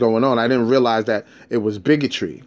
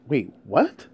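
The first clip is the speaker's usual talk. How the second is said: confused disbelief; 5 dB quieter than usual. Retold or imitated. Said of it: "I'm like".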